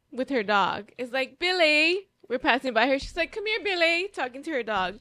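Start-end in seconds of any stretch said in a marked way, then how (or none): 1.4-2.1 higher, imitated British call; British accent. Lady calling to her dog, British accent. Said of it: "In that accent". 3.3-4.1 high imitated British call; British accent. Lady talking to her dog, British accent. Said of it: "In that accent".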